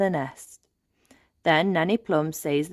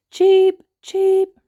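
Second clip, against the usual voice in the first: much higher, much louder giant chick cheeping; very loud chirps; 10 dB louder than usual. Acted. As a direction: blast the chirp out like an enormous chick. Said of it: "chirps the baby bird very loudly".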